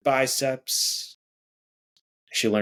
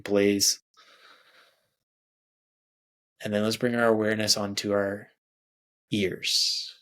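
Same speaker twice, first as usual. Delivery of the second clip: guided meditation. Acted. Meditation guide leading listeners through breathing, soothing. Leads a guided body scan.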